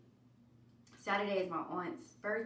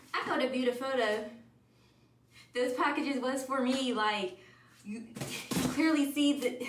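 louder, higher, exasperated; fired up at the delivery man's mistake; heated; 5 semitones higher, 6 dB louder than usual. Real, not acted. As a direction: let the annoyance boil over. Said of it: "he got me hot".

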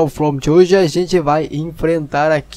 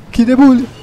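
higher, louder cheer; excited shout; 6 semitones higher, 5 dB louder than usual. Real, not acted. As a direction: shout it in triumph. Celebrating a kill, louder. (grito animado de vitória)

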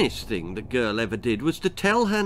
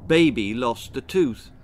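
ordinary delivery

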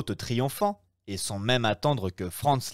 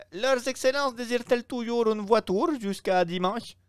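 innkeeper, fussy; 13 semitones higher than usual. Acted. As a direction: play the eager Roman host, pitched up. Innkeeper Pastrini voiced. (aubergiste empressé, voix plus aiguë et plus forte)

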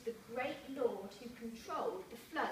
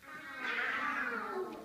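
ow; 5 semitones higher, 4 dB louder than usual. Acted. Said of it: "what do you think he said".

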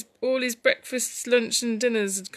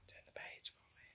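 hushed whisper to a little helper; whispered aside; 27 dB quieter than usual. Real, not acted. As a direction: whisper it privately, under the story.

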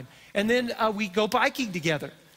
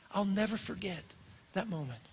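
moved; 8 dB quieter than usual. Real, not acted. Emotional memory.

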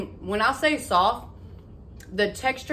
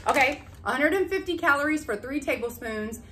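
ordinary talk

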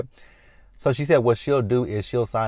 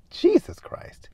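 slow, whispered shock; slower than usual. Retold or imitated. Recapper reacting to the stabbing, shocked whisper. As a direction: whisper it slowly, aghast.

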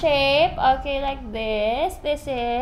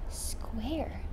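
put-on soft hint for the child; whispered prompt; 4 semitones lower, 11 dB quieter than usual. Shown as a demonstration. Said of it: "This is".

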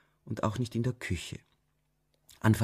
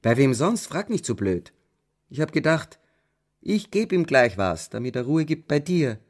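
louder, rough scolding mother; harsh retort; 4 semitones higher, 6 dB louder than usual. A narrator's reading. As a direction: snap back, irritated and blunt. Mother snaps at daughter. (Mutter fährt die Tochter an, laut und gereizt)

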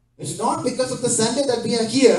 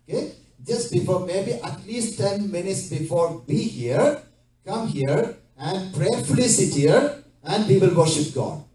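normal talking voice

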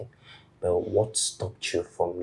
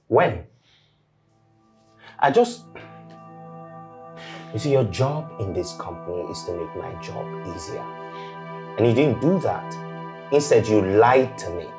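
raised, much higher angry scolding; harsh angry tone; 5 semitones higher, 6 dB louder than usual. Acted. Boss scolding the lying assistant, loud and angry. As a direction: let the boss blow up at her. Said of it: "why will you speak to me in such a harsh tone".

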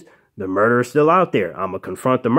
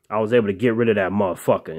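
usual speaking voice

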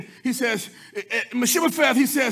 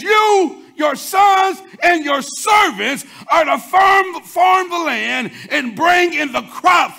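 royal command; 7 semitones higher, 8 dB louder, much slower than usual. Retold or imitated. David commands Ziba.

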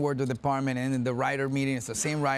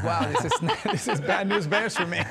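laughing; 6 semitones higher than usual. Retold or imitated. Quoting himself, laughing.

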